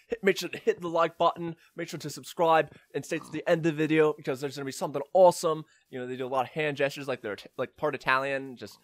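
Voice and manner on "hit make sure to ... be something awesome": higher-pitched British vlogger pitch; British YouTuber. Acted. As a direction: plug the channel in a British accent. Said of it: "a British person saying".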